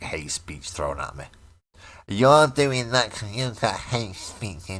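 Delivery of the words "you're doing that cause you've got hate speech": high, slow whiny parody; mocking accuser. Imitating those throwing accusations at him, mocking whine. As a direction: drag it out in a raised mock voice.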